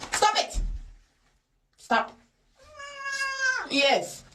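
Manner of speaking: high yelps of stop and ow; pleading high with a squirming dog; restraining a pet. Real, not acted. Dog fights the bath.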